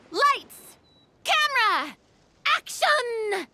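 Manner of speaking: high, ringing call to start the take; film director's cue to the whole set. Acted. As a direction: call each word out high and drawn out.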